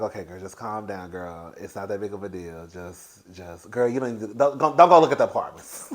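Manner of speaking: calm yourself like a friend; self-soothing. Retold or imitated. Calming herself, retold.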